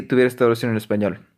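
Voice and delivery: normal talking voice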